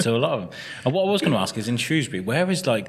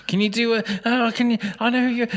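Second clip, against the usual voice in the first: eager fan, much higher; excited fan; 13 semitones higher than usual. Retold or imitated. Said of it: "somebody like".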